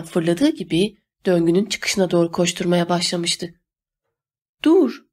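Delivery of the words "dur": high, sharp mild shout. A narrator's reading. Emma orders a fleeing man to halt, shouting. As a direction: call it out as a high command. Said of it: "diye bağırdı Emma".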